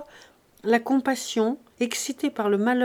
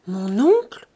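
startled question, leaping up at the end; shocked question; 6 dB louder, slower than usual. Acted. Daughter hearing of her uncle's death, shocked question. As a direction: ask it in disbelief, louder and sharply rising. Girl learns of uncle's suicide.

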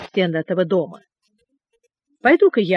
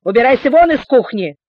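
angry shout; 4 semitones higher, 6 dB louder than usual. A narrator's reading. Cook orders Julian out.

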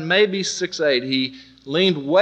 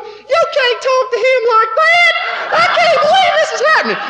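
prissy blonde lady; 6 dB louder than usual. Retold or imitated. Bleached blonde coworker scolding during the shouting match, squeaky and aghast. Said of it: "bleached blonde who prisses around".

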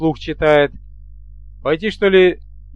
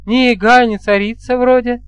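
higher-pitched, lightly amused female voice; amused young woman; 6 semitones higher than usual. A narrator's reading. Bride answering her groom's silly question, gently amused. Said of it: "смешливо фыркнула Алёна".

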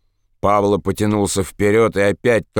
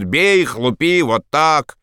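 raised-pitch egging-on cries; drunken yelling; 6 semitones higher, slower than usual. A narrator's reading. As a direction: keep yelling the mob on. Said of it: "всё орёт". (пьяный ор)

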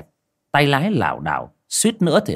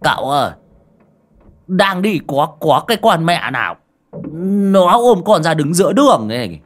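higher, louder tipsy protest; drunken explaining; 6 semitones higher, 6 dB louder than usual. A narrator's reading. Drunk driver explaining to his master about the woman, tipsy protest. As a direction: plead your case drunkenly. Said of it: "giọng nói sặc mùi men".

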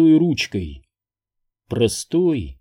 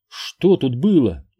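strained; 2 semitones higher, slower than usual. A narrator's reading. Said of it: "немного натянуто поинтересовалась она".

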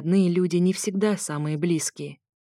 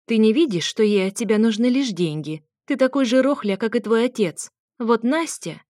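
higher, louder scolding mother; angry mother; 5 semitones higher, 4 dB louder than usual. Acted. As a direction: berate your son at the top of your voice. Mother attacks son. (мать в гневе кричит на сына, выше и громче)

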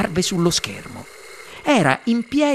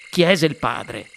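plain reading voice

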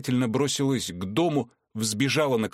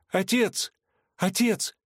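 much higher desperate calls; desperate cry; 9 semitones higher, slower than usual. A narrator's reading. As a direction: cry out to her father in despair. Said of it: "в отчаянии воскликнула Вероника".

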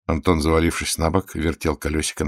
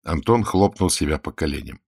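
plain reading voice

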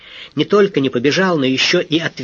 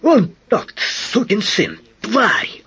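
much higher, cursing bellow; furious yelling; 7 semitones higher, much slower than usual. A narrator's reading. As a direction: scream curses in a rage. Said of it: "орал Локстон".

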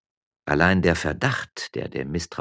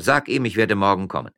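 raised voice, pitched up; calling out; 4 semitones higher, 6 dB louder than usual. Acted. Miller puts off the policeman, calling out. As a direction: call the reply across. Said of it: "rief der Müller".

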